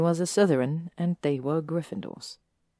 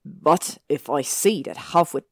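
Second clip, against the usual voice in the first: warning; 4 dB louder than usual. Acted. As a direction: menace it into her face. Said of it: "He stared into her face and warned".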